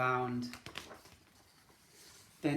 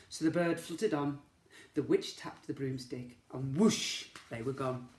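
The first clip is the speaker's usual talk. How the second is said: plain reading voice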